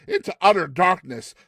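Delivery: plain reading voice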